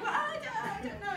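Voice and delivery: high, thin acted bewilderment; flustered reply. Delicate new mother answering her mum, high and flustered. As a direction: squeak out a helpless answer. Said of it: "I was like".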